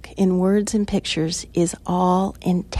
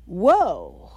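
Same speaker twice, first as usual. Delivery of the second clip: whoa. Retold or imitated. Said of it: "the intention of her was to say".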